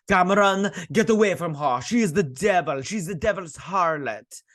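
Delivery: mock shrill warning from an old grandma; grandma yelling.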